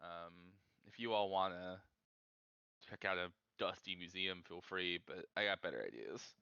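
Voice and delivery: low, soft in-character loner brushing the group off; aloof loner. Acted. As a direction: decline the outing in a low, quiet voice. Plays RJ declining the museum outing.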